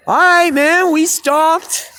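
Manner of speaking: high, loud mock call up to the window; retold call back. Musician calling back to the angry neighbor, high loud mock reply. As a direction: shout an exaggerated reply to the heckler. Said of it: "You know, I'm like".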